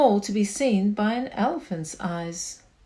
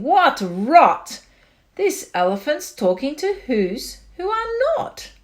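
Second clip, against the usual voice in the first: louder, higher jeering shout; mocking shout; 6 semitones higher, 5 dB louder than usual. A narrator's reading. Wickersham brothers shouting at Horton, loud jeers. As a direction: shout the elephant down. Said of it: "came shouting".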